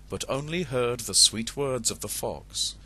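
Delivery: ordinary delivery, nothing marked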